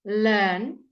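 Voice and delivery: Learn with a long er vowel, no R; teacher models Learn for the class to copy; pron model word. Shown as a demonstration. Teacher models the word for students, clear and slow. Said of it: "so here".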